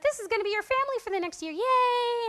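fast, high, mock-cheerful announcement; retold peppy welcome. Leaders welcoming the new group, mock-peppy.

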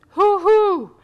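high, loud gleeful hoot; excited hoot. Real, not acted. Mother cheering for her kids' Narnia CDs, gleeful hoot. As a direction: let out a delighted hoo-hoo.

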